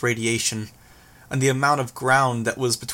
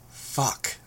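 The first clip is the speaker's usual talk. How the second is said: muttered; 7 dB quieter than usual. A narrator's reading. Hero reacting to the escape news, muttered curse. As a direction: swear softly under your breath. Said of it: "I muttered".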